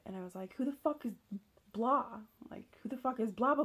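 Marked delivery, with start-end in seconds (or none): 0.5-2.0 raised, louder furious demand; angry confrontation. Retold or imitated. Confronting partner about the messages. 2.5-3.6 higher, louder furious demand; angry repeated demand. Retold or imitated.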